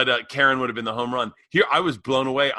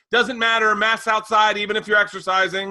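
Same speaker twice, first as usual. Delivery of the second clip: higher, louder scolding neighbor; bossy woman voice; 6 semitones higher, 4 dB louder than usual. Retold or imitated. Retelling the woman in the park, higher loud scolding. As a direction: bark the rule at him like a busybody. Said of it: "And she goes".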